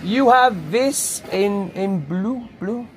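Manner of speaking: slow, careful foreigner-talk to a shopkeeper; slow simplified English. Real, not acted. Asking the shop seller, slow and simplified. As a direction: spell it out slowly for the seller.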